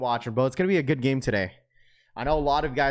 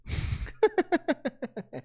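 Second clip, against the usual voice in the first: high giggle; 4 dB quieter than usual. Real, not acted. Loud high laugh.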